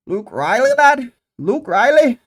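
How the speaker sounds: high, loud mock Scouse accent; a hammed-up Liverpool accent, calling the name out. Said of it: "Gotta work on my fucking Scouse accent".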